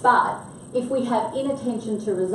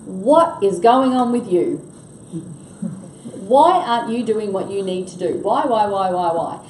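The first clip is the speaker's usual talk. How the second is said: pressuring demand; 6 dB louder than usual. Shown as a demonstration. Manager grilling a team member who missed commitments, loud and pushy. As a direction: fire the whys at him, louder each time. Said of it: "So I'm asking question, question, question".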